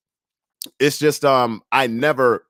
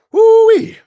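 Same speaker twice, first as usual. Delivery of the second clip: loud high drawn woo-wee; woo-wee whoop; 13 dB louder than usual. Real, not acted. Feeling the whiskey, loud high woo-wee holler.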